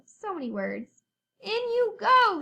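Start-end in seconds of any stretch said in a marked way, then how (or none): 1.4-2.4 high, loud little F voice; character line. Acted. Narrator voicing little F tossing frogs into her box. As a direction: call it out, bright and high. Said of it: "she said".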